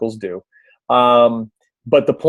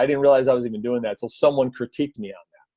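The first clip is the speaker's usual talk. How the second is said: ordinary delivery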